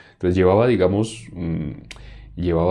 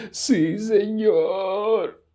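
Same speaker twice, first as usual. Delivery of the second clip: high, drawn-out mock-devout wail; weird prayer voice; 12 semitones higher, much slower than usual. Preacher mimics someone praying with eyes squeezed, weird prayer voice. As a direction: strain out a parody of heartfelt praying. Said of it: "una voz toda rara".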